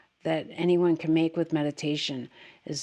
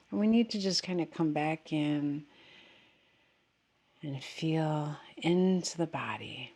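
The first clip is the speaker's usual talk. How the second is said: softer, unhurried meditation voice with long pauses; guided meditation; 3 semitones lower, 4 dB quieter than usual. Real, not acted. Teacher guiding meditators to settle into the body, guided meditation. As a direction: gently settle them, leaving space after each phrase.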